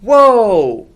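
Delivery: long loud high amazed whoa, falling; a big amazed crowd whoa; crowd gasp. Shown as a demonstration. The crowd amazed by Zach's promise, loud whoa. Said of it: "We're gonna try this all together now".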